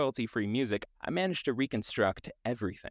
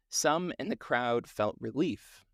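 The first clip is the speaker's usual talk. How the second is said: ordinary talk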